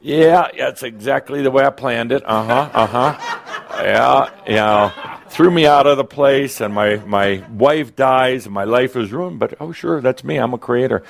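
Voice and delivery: hammed-up ironic "oh, sure" complaint; Yeshua's sarcastic disbelief. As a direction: play someone scoffing at their own ruined plans. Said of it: "you think it was easy for Yeshua to trust himself?".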